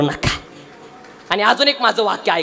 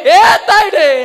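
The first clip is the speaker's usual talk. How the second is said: loud cry across the room, higher; calling out; 5 semitones higher, 6 dB louder, much slower than usual. Acted. Father calling out to his daughter at the police station, loud. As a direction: call your daughter back.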